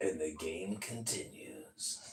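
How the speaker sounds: creepy low whisper. Acted. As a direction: whisper it like a horror villain. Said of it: "that was a creepy voice".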